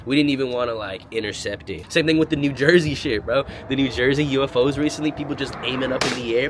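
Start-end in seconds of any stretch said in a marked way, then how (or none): none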